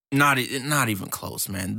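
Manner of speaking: ordinary talk, nothing marked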